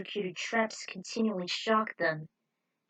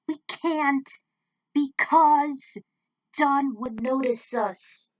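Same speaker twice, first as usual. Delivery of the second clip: louder, slow, tense warning; mild hammed-up alarm from the girl; urgent objection; 5 semitones higher, 8 dB louder, much slower than usual. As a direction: stop him, afraid they'll be caught. Lily objects, fearing capture.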